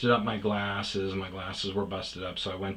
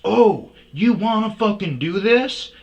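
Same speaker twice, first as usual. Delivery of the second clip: much higher and louder mock yell; hammed-up fighting shout; aggressive shout; 13 semitones higher, 13 dB louder than usual. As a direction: bellow a challenge like a brawler. Attacker challenges after punch.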